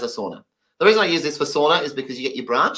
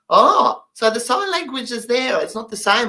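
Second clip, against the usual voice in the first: higher, naive questioning voice; mock hearing person; 9 semitones higher than usual. Hearing students ask the deaf teacher, mock naive high voice. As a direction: play the puzzled hearing learner, pitched up. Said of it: "the common statement they always say".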